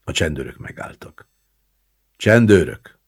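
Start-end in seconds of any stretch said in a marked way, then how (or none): 2.2-3.0 loud, raised-pitch self-announcement; loud, booming announcement. A narrator's reading. Gendarme announcing the police on arrival, loudly. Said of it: "mondta egyikük harsányan".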